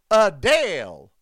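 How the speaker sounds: slow, loud Southern uh-DALE; stretched Southern vowel; Southern Baptist accent. Shown as a demonstration. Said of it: "act like you are a Southern Baptist".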